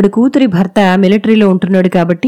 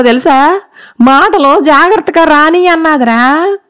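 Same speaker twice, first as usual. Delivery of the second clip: high, loud dialect rant, wailing by the end; a mother-in-law loudly whining about her daughter-in-law; loud complaining mother-in-law; 4 semitones higher than usual. Acted. Mother-in-law complaining loudly to her son about his wife. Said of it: "గట్టిగా మాట్లాడడం మొదలుపెట్టింది".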